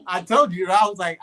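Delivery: ordinary delivery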